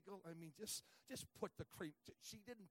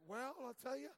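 wry joke; 4 semitones higher, 10 dB louder than usual. Retold or imitated. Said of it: "I said".

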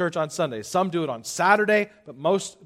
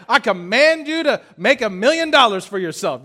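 raised, loud mock order; preacher hams up a grand command, raised and loud; 6 semitones higher, 7 dB louder than usual. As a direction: bark it out like a pompous boss. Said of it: "I'd be like".

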